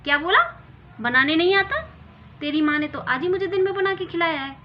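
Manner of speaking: loud, high furious yell; son-in-law shouting at his wife; angry shout. Acted. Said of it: "चिल्ला के बोले".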